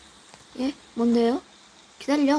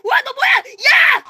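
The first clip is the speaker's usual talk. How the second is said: startled shout; 9 semitones higher, 5 dB louder, slower than usual. Real, not acted.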